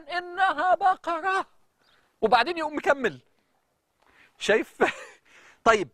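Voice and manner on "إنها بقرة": slow, higher drawn-out "إنها بقرة"; slow emphatic recitation of "إنها بقرة"; reciter's slow emphasis. Shown as a demonstration. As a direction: lean on "إنها بقرة" as the imam did, slow and lifted. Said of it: "بطريقة قرايته للقرآن".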